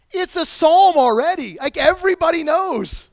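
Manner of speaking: loud, high, animated exclaim; loud high-pitched excitement; excited outburst. Real, not acted. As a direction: burst out with energy. Excited exclamation mid sermon.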